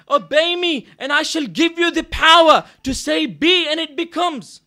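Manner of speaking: loud, ringing sermon declaration; preaching at full force. Acted. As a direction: proclaim God's promise to the whole hall. Preaches God's words at full force.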